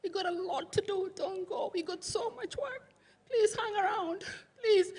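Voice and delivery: soft, high, tearful begging with catches of crying; tearful pleading. Retold or imitated. Sister begging her dying husband not to go, tearful and soft. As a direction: plead softly through tears, asking him to stay. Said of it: "She was saying".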